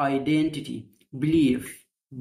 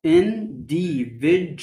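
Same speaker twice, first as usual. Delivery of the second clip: individu- very slow, du as ju; slow syllables; 3 semitones higher, much slower than usual. Shown as a demonstration. Teacher sounding out individu for students. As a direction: drag out individu- slowly. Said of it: "بنطقها ج".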